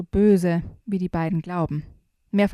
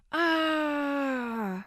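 high, sudden cartoon yelp; startled cry; 7 semitones higher, 5 dB quieter than usual. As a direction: yelp in surprise. Character startled, jumps after.

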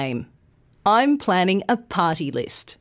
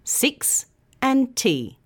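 slow "six and T", each syllable apart; syllables split; 5 semitones higher, slower than usual. Shown as a demonstration. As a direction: break sixty into parts. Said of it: "So does sixty".

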